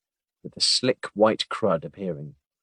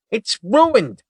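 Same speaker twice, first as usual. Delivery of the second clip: high, louder plea; pleading; 13 semitones higher, 7 dB louder than usual. Acted. Agent to partner over his jacket, pleading. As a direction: beg for sympathy. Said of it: "he pleaded".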